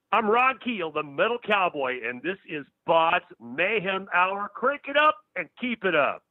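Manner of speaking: big announcer read for the show; radio promo. Pretend. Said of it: "would you care to do a promo for my show?".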